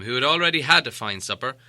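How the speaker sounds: usual speaking voice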